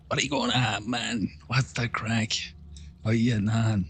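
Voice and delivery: put-on lightly thickened Irish accent, easy and chatty; a hint of pub-talk Irish accent; thick Irish accent. To the lads after a few pints, broad Irish accent. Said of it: "when I get too many pints in me and I'm at home with the lads".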